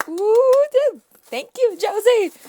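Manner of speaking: very high, loud delighted squeal; shrieking thank you; excited squeal. Real, not acted. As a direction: squeal with joy at the gift. Squealing over a happy mail gift.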